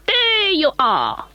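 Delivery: very high, piping call; high little elf calling. A narrator's reading. Mister Munty voiced.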